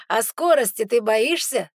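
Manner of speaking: loud, slow teasing question; smirking. A narrator's reading. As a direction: tease him with a smirk. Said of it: "с усмешкой спрашивает Ярослав".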